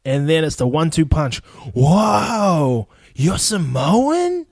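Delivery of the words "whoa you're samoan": high, drawn-out amazed whoa and rising question; mock astonishment. American learning he is Samoan, hammed-up astonishment. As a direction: exaggerate a stunned, impressed reaction.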